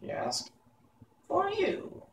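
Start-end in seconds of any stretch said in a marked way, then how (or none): 1.3-2.0 curt high witch answer; brief witch reply. Acted. Witch Mombi answering boy Tip curtly, high. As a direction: cut him off short. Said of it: "returned Mombi briefly".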